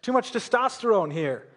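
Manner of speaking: amused aside, laughing as you say it; a laughing quip. Real, not acted. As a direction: chuckle through the joke about men. Joke to the congregation.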